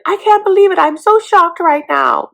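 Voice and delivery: high, loud fake outrage; hammed-up gasping drama queen; mock shocked Amira. Amira posting the screenshot, hammed-up high shock. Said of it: "Amira screenshots the comment and is like".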